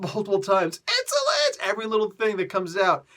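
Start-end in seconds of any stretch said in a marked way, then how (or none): 0.9-1.7 shrieking very high; hammed-up furious alien cry; mock screaming. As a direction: scream insolent in outrage. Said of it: "They're literally screaming".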